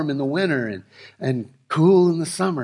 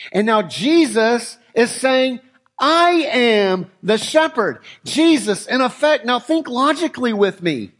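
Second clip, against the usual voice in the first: louder, higher preaching with slow, drawn-out phrases; rising preaching; 5 semitones higher, 4 dB louder than usual. Acted. Preacher stirring the congregation about King David, building fervour.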